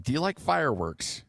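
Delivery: ordinary delivery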